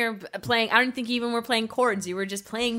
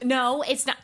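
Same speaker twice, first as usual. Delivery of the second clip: normal talking voice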